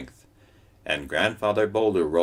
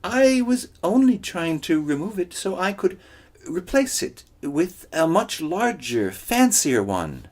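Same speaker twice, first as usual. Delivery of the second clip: high, wheedling and slowing; Coyote voice; 13 semitones higher, 6 dB louder than usual. Acted. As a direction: coax the rock, drawing out the end. Coyote voice continuing.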